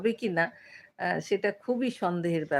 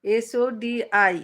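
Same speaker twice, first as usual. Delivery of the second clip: very slow letter-by-letter spelling; much slower than usual. Shown as a demonstration. Host checking a viewer's name, spelling it very slowly. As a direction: draw out each letter on its own. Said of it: "Saudi বা উচ্চারণ হবে".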